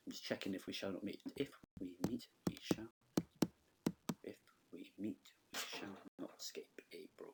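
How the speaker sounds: soft, checking the line under her breath; muttering to herself. Real, not acted. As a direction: murmur it to yourself, checking. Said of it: "thinking to myself there".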